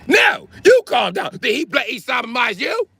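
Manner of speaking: a hammed-up shrieking Brit. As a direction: scream the order back at them. Brit yelling back.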